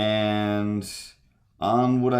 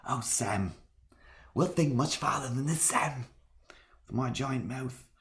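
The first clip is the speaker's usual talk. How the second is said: soft, mostly high put-on hobbit voice; hobbit companion; 5 semitones higher, 7 dB quieter than usual. Hobbit replying to Sam about thinking farther, soft character impression. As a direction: answer quietly in a higher character voice.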